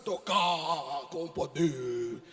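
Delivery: low, echoing spooky voice ending in a loud, very slow boom; mock mystical God voice. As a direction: parody a mystical God speaking with echo. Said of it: "Aí fica assim". (imitação zombeteira da voz mística de Deus)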